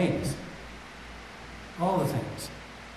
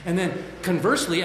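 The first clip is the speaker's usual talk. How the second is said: ordinary talk, nothing marked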